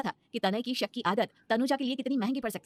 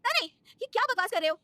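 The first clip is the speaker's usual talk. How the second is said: much higher, indignant cry; shocked protest; 13 semitones higher, 4 dB louder than usual. Acted. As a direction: hit back in outrage. Wife protests accusation. (बहुत ऊँची, आहत गुस्से वाली आवाज़)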